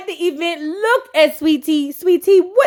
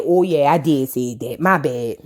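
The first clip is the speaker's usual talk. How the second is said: much lower, sheepish admission; lowered, grudging apology; deep mock Toya; 12 semitones lower than usual. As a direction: drop the voice way down, guilty. Toya voiced, admitting fault.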